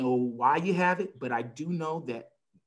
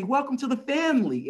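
much higher, bright mock welcome; chirpy staff greeting; 11 semitones higher, 4 dB louder than usual. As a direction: sing it out like a cheery receptionist. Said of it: "they say".

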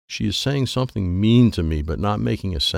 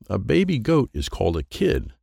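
ordinary delivery

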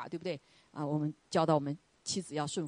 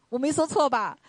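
raised, louder playful challenge; husband teasing his wife, raised and playful; 8 semitones higher, 8 dB louder than usual. Retold or imitated. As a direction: needle her good-naturedly. Husband teasing after apology.

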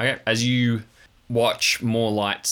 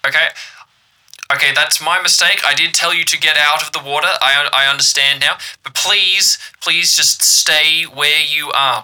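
loud radio call; 6 semitones higher, 8 dB louder than usual. Acted. Radio caller pleading.

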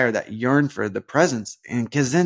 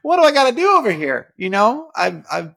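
much higher, louder, fast disbelieving plea; exasperated come on; 9 semitones higher, 4 dB louder than usual. Retold or imitated. The Lord watching him fail, playfully exasperated at him. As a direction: throw up your hands in mock frustration. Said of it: "he's gotta watch me and just be like".

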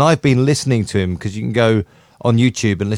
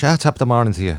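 lightly put-on Irish accent; throwaway stage-Irish accent; faster than usual. Shown as a demonstration. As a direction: drop in a token Irish greeting. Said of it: "the Irish".

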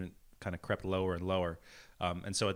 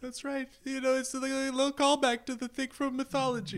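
much higher, strained whine; high whiny voice. Pretend. High mock sob voice.

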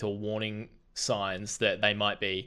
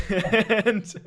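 high, loud laughing word; 11 semitones higher, 10 dB louder than usual. Real, not acted. Host on ways their business should improve, laughing.

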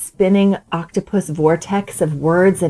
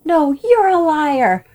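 put-on child accusing; 8 semitones higher, much slower than usual. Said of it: "shouting out accusations like".

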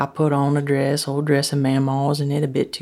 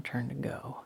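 plain reading voice

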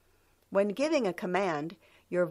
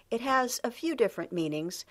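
ordinary talk

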